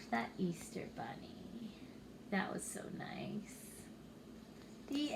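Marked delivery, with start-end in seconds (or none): 0.1-3.6 soft unvoiced secret reveal; whisper. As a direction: whisper the magic to the kids. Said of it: "hushed reveal to children".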